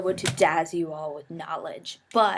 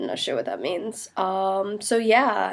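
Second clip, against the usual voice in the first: ordinary delivery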